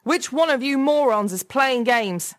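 high, loud yell at the whole office; furious call across the room; angry shout. A narrator's reading. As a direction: shout them down, exasperated. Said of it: "he shouted across the office".

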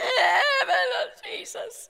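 loud high strangled cry to Jesus; panicked scream. Retold or imitated. Speaker finally screaming at the bear, loud high cry. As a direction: let out the scream you couldn't. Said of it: "I need to scream".

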